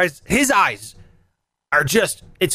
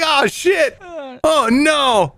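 panicked dismay; 7 semitones higher, much slower than usual. Retold or imitated. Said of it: "I'm like".

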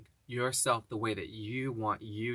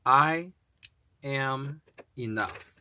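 slow affirmation; 3 semitones higher, 5 dB louder, much slower than usual. Acted.

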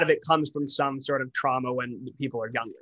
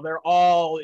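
ordinary delivery

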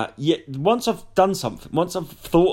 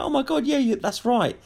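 excited agreement; 10 semitones higher than usual. Retold or imitated. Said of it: "someone go".

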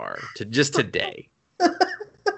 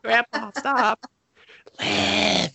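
put-on higher, slow pleading kid; high grandson voice; 9 semitones higher, much slower than usual. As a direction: squeak it like a harassed child. Acted grandson voice.

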